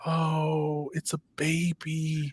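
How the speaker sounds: soft, slow, melting coo; tender cooing. Retold or imitated. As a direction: coo quietly over a tiny baby animal. Retells seeing the baby animal.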